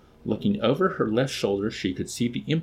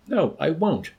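high-pitched scared refusal; frightened and defiant; 7 semitones higher than usual. A narrator's reading. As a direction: refuse defiantly despite the fear. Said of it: "The voice was frightened and defiant".

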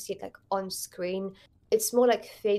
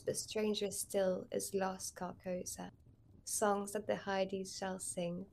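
soft, fading recital of a poem; poem recitation; 7 dB quieter than usual. Shown as a demonstration. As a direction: recite it hushed and solemn. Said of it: "Can you still recite the poem?".

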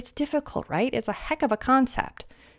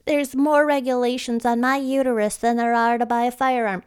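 mocking woman voice.

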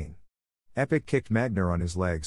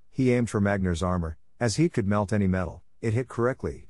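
plain reading voice

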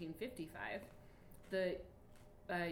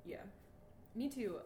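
ordinary delivery

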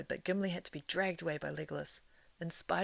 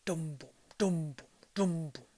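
wild drum beats; 3 dB louder than usual. A narrator's reading. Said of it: "drum beats broke out wildly".

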